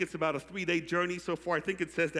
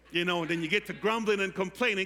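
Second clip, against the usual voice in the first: ordinary delivery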